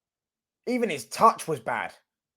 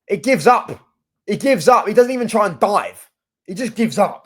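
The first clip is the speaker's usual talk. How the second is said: loud, higher-pitched disbelief; exasperated outburst; 5 semitones higher, 7 dB louder than usual. Real, not acted. Fan ranting about the keeper conceding, loudly exasperated. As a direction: raise the voice in real exasperation.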